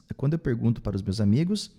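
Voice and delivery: normal talking voice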